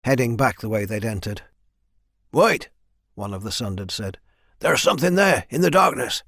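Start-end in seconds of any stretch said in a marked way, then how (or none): 2.3-2.7 high, louder interruption; calling out. A narrator's reading. Soldier halting the departing leader, calling out. 4.6-6.2 high, animated soldier voice; alarmed warning. A narrator's reading. Soldier pointing out something in darkness, alarmed.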